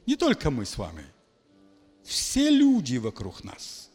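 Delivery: ordinary talk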